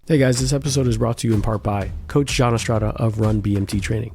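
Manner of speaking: upbeat sponsor plug; ad read. Acted. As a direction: sell the coach like a radio spot. Host reads sponsor ad.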